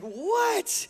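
high-pitched, incredulous question; baffled disbelief. Retold or imitated. Man mistaken for [name] in Target, baffled. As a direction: act out a creeped-out double take. Retold reaction to mistaken identity.

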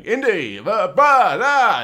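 mock booming British actor; loud British voice. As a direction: boom it like Brian Blessed. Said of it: "really kind of loud and British sounding".

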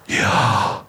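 Yahweh as pure breath, inhale-exhale; Yahweh whispered like slow breathing; breathing sound. Acted. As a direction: breathe "Yahweh" as two long voiceless puffs, YAH-WAH. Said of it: "He breathed the breath of life".

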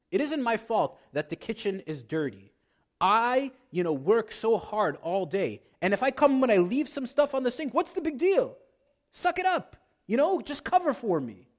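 rising outrage, brushing off the complaint; defensive whining. Pretend. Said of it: "shifts blame to your partner".

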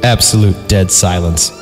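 ordinary delivery

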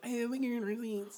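meek, softly voiced, tentative approach; timid mock mumble; non-confrontational. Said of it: "I'm so non-confrontational".

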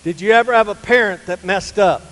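loud, rising preacher's questions; preaching challenge. Acted. Preacher pressing the church on their troubles, loud and rising. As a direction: press the congregation with each question.

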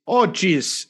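hammed-up Wisconsin "Oh, geez"; Wisconsin accent. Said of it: "in my best Wisconsin accent".

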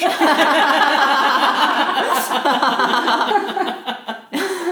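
big high burst of laughter; loud high laughter. Real, not acted. Laughing loud at her own punchline.

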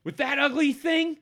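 high, loud taunt; hot-headed halfling mocking a rival; scornful halfling. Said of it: "He says".

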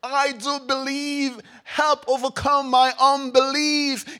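slow, pleading cry of a desperate father; a father's slow, loud plea; desperate exclamation. Acted. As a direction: beg for help with your whole chest.

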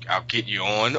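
usual speaking voice

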